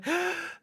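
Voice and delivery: sharp high gasp for effect; dramatic gasp. Acted. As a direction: gasp in mock shock. GM dramatic reveal.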